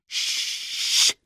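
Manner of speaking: drawn-out "shh"; long shushing hiss. A narrator's reading. Harry silencing the noisy elf, hissed shush. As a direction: hush him with a long hiss. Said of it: "Harry zischte".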